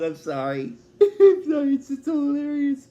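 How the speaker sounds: helplessly amused, voice rising as the laugh takes over; laughing apology. Real, not acted. Fan retelling a hilarious robot scene, laughing apology. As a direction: apologise through real giggles, pitch climbing.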